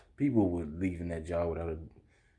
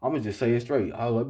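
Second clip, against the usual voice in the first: usual speaking voice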